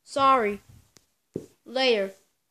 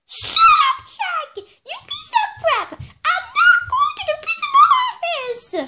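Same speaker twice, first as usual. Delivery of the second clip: very high, loud cartoon shout with laughs; high-pitched puppet tantrum; squeaky angry character; 5 dB louder than usual. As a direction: shriek back defiantly, high and giggly. High-voiced character shouting back.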